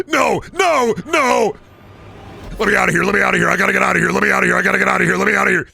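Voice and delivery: mock terror, desperate to escape; panicked shout. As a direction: scream to get out, hammed-up panic.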